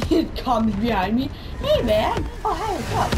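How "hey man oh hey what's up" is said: high, loud, playful hello; high-pitched greeting. Pretend. Gamer greeting the enemy who comes behind, high and loud.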